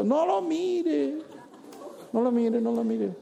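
playful warning. Acted. Teasing about the sad person.

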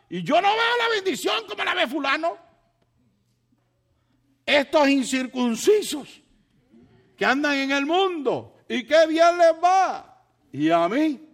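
high, loud, aggrieved complaining shout; jealous outcry. Retold or imitated. A churchgoer complains to God about others' blessings, loud and bitter.

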